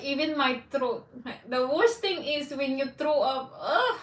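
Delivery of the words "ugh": disgusted ugh. Real, not acted. Recalling throwing up.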